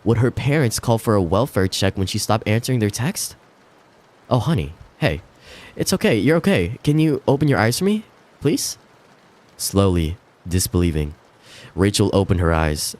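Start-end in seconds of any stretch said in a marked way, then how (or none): 4.3-8.8 a hint of a soothing husband; gentle reassurance. Husband comforting sobbing wife.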